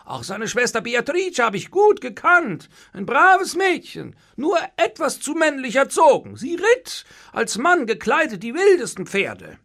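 high, loud, agitated recollection; dismayed. A narrator's reading. Innkeeper remembering the dead guest and sister, dismayed. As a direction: pour out the memories, upset. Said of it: "antwortete Tebaldo betroffen".

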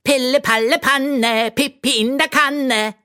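a silly magic hat proclaiming; talking hat. A narrator's reading. Enchanted hat greeting the girl, high silly chant. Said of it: "rief der Hut".

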